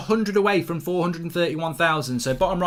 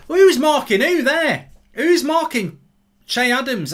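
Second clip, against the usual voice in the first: exasperated yell; 9 semitones higher, 6 dB louder, slower than usual. Real, not acted. Exasperated at defending, match stream.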